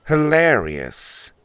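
slow, deadpan hilarious; dull. Acted. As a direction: say it with no amusement. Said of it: "he said dully".

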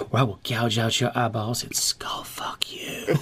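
slow breathy sexy whisper. Pretend. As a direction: whisper it slowly, trying to sound sexy. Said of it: "Do it in a sexy voice".

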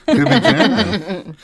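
slow, high, laughing echo. Real, not acted. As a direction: savor the pun with a giggle. Echoing pun with laugh.

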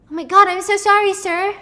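high, loud, rushed apology; a frantic waitress begging pardon; waitress gushing sorry after spilling whiskey; flustered waitress. Acted. Waitress spills drink on him.

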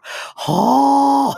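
a loud hooting laugh, head thrown back; Jerry Clower haw. Retold or imitated. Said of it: "giving a Jerry Clower".

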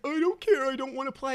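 put-on high-pitched little boy pleading; whining child. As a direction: imitate a kid who wants out. Voicing his son refusing.

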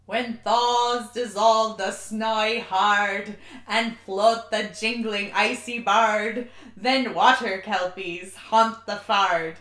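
slow, loud reading in a put-on Scottish accent; mock Scottish accent. Shown as a demonstration. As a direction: recite the Burns poem in a shaky Scottish accent. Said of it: "try to do an accent that I have no business doing".

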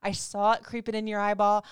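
ordinary talk, nothing marked

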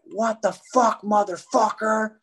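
mock yell at the cop; retold cursing at cop. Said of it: "I was like".

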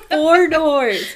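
loud laughing. Real, not acted. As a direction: laugh through the words. Laughing joke continuation.

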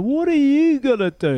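high, loud mocking taunt from a laughing rival; taunting giant. Giant forward taunts young player over the ball, high loud mockery. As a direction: imitate the big forward sneering down at you in the ruck. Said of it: "he's laughing at me, and he's just going".

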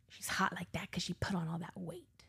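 grandma's whispered dig, imitated; under the breath. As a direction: mutter it softly, almost voiceless. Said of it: "she says it under her breath".

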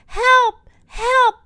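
high, loud cries for help; storybook shout of alarm; acted yell. A narrator's reading. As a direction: yell for rescue. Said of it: "Lionel yells".

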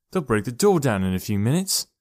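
ordinary delivery

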